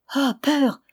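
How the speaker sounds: high anguished cry. A narrator's reading. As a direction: cry out to her father in alarm. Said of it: "s'écria-t-elle".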